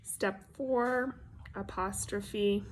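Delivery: ordinary talk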